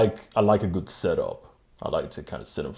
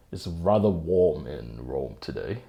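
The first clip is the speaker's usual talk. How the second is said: ordinary talk, nothing marked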